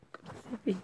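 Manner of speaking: breathy whisper through a giggle; softly giggling, almost voiceless; whispered laugh. Real, not acted. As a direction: laugh it out under your breath.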